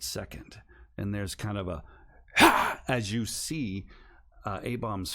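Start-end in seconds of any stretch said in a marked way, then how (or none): 2.4-2.8 sudden high, loud burst of breath; a spirit shrieking as it is torn loose; sharp demonic gasp. Acted. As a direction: otherworldly yelp. Spirit tears through for a second.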